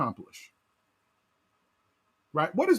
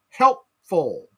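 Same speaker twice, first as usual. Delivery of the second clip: "helpful" said louder, underlined; stress on helpful; 2 semitones lower, 5 dB louder than usual. Shown as a demonstration. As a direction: lean hard on "helpful" to underline it. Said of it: "underline this".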